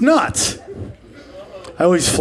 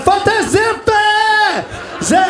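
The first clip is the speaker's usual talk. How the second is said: high, slow mock shouting; mock yell; 12 semitones higher, much slower than usual. Comic telling off a stranger, high mock yell. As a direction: bark it out in a silly high voice. Acting out a loud outburst.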